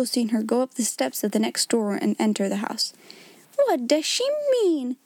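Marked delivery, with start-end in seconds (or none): 3.6-5.0 cried out. A narrator's reading. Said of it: "cried Miss Minchin".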